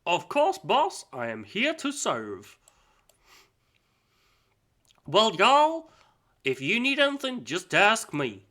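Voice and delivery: loud, high-pitched hammed-up Southern drawl; hillbilly Southern accent. Servant answering his boss in a loud Southern voice. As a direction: play the eager hillbilly servant, big and bright. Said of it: "some guy who comes, like, hillbilly".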